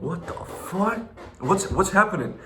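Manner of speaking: high, squeaky what the fuck; shocked yelp of disbelief; stunned disbelief. Retold or imitated. Seeing Daniel G outside his home: high stunned disbelief.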